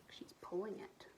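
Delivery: soft unvoiced aside; whispered aside. Real, not acted.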